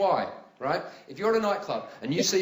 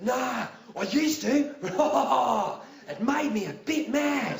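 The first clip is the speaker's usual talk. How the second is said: Steve Irwin voice; 5 semitones higher, slower than usual. Retold or imitated. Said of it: "And Steve Irwin actually said".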